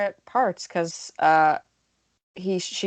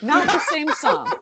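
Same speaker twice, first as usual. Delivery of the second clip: high-pitched, laughing protest; 10 semitones higher, 5 dB louder, slower than usual. Real, not acted. Co-host cracking up over the band's song, laughing. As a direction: get the words out through giggles.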